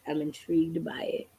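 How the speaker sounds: hushed whisper, nearly breath. Real, not acted. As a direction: lean in and whisper it. Drops to whisper.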